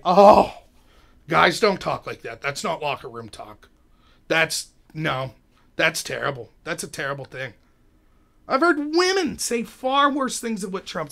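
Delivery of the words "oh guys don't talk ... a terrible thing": mock disapproval. Hypocrites tutting at locker room talk, mock disapproval. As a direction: imitate a pious public scolding.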